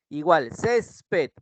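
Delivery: césped stretched very slowly with the weight on cés; césped spoken slowly, the stress on cés; stressed example. Shown as a demonstration. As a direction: drawl out césped, hitting cés. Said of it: "En ces recae la mayor intensidad".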